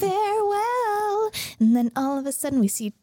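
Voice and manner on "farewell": high, drawn-out goodbye; hammed-up parting call; theatrical farewell. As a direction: stretch it out high like a grand goodbye. Acting out decoy's grand goodbye.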